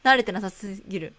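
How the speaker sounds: normal talking voice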